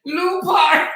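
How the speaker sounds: slow, loud, high oh my God; drawn out in disbelief; shocked. Real, not acted. Host reacting to the clip in loud disbelief.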